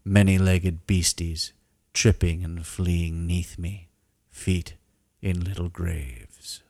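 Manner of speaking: slow, solemn poem reading; haiku recital. Shown as a demonstration. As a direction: recite it like grave verse. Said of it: "I've composed a haiku that I feel captures the moment".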